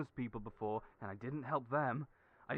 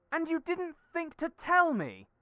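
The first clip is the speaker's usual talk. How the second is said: high, loud disbelieving cry; shocked outburst; 13 semitones higher, 10 dB louder than usual. Acted. Friend hearing Julian was laid off, shocked loud outburst. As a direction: burst out hurt and stunned. Friend learns of job loss.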